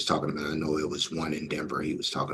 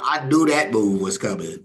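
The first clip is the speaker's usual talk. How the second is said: usual speaking voice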